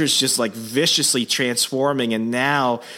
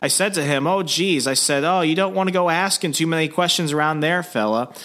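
higher, folksy bartender voice; Minnesota bartender; 6 semitones higher than usual. Acted. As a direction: play a chatty local bartender, pitched up. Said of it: "And the bartender's like".

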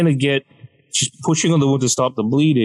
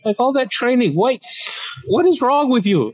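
much higher, incredulous scolding; exasperated dad; 13 semitones higher than usual. Retold or imitated. Dad scolding his injured son, high incredulous rant. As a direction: scold like a disappointed martial-arts dad. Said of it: "his first response was".

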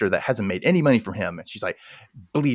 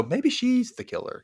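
mock suspicion; 14 semitones higher, 3 dB quieter than usual. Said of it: "it made me go".

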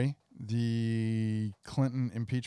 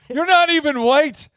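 loud, high put-on taunt; mock rival insult; 13 semitones higher, 13 dB louder than usual. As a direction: bellow the taunt in character. Plays an insulting British Isles rival.

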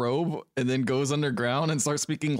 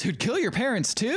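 high-pitched incredulous question; imitating an astonished reaction; mock disbelief; 11 semitones higher than usual. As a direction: deliver a quip as pitched-up astonishment. Retold quip in mock disbelief.

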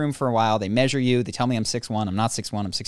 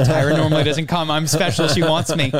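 mock-giddy, louder and higher; raised voice, playfully starstruck; giddy excitement; 4 semitones higher, 7 dB louder than usual. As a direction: gush it like a flattered fan. Replays own giddy reaction, louder.

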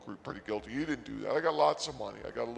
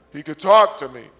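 sudden emotional shout; screamed excitement; 17 dB louder than usual. Real, not acted. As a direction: burst out far louder, carried away. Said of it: "Sorry, I screamed".

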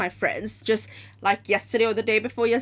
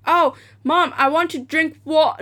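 higher, louder asking; loud pleading; 6 semitones higher, 6 dB louder than usual. Retold or imitated. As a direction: plead with your mom, loudly. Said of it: "I said".

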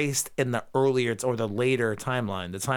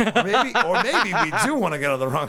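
louder, fast, laughing mischief; laughing tease; 3 semitones higher, 4 dB louder, faster than usual. Real, not acted. Host daring Schemmel's Twitter rant, laughing. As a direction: giggle through the dare.

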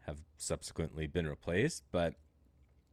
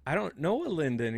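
puzzled reply; 10 semitones higher, 7 dB louder than usual. Retold or imitated. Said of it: "I'm like".

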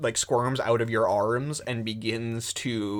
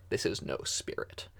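normal talking voice

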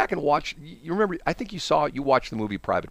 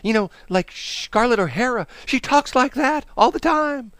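put-on high, melodramatic old-movie actress voice; Scarlett O'Hara; 10 semitones higher than usual. Host mimics women in old movies, high and melodramatic. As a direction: swoop up high like an overwrought film heroine. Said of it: "it's so melodramatic".

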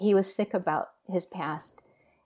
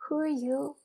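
Jesus asking gently; 9 semitones higher than usual. Retold or imitated. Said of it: "and he said".

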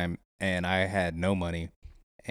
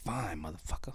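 grudging whisper; whispered retort; 4 semitones lower, 12 dB quieter than usual. Retold or imitated. Man grudgingly giving in to a friend, whispered retort. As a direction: whisper it under your breath, giving in.

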